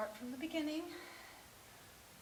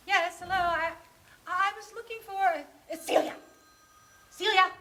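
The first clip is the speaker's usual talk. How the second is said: loud, higher, halting telephone voice; awkward, over-loud caller; rehearsed phone greeting; 5 semitones higher, 12 dB louder than usual. Acted. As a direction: announce yourself haltingly down the phone. Rehearses a nervous phone call.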